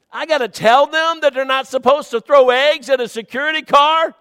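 rising into high, loud incredulous exclaiming; mock disbelief. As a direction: act out shocked disbelief at the rulebook. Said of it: "And I read that and I thought".

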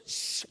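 sharp short "shh"; shush. Retold or imitated. Buddy silences the hunter, quick shush. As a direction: cut him off with a shush.

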